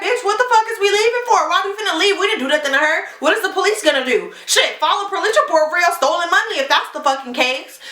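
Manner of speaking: high-pitched angry rant, half performed; still mad. Retold or imitated. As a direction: fire it back at your friend, outraged and quick. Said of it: "I'm still mad".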